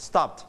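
"stopped" in one syllable with a light final t; softened t ending. Shown as a demonstration. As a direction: tuck the t of "stopped" in, no extra vowel. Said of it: "มีเธอเบาๆ".

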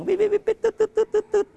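rapid mimicked clicking chirps; bat detector chirps. Shown as a demonstration. Expert mimics bat detector sound for kids. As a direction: copy the detector's beeping clicks. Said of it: "มันก็ดังวิบวิบวิบ".

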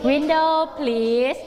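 Window please drawn out; slow model reading. Shown as a demonstration. Teacher modelling the phrase. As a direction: say it slowly for pupils. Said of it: "ต่อไปอีก".

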